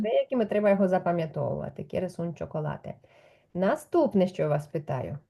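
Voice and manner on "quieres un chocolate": soft slow model. Shown as a demonstration. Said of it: "teacher models question".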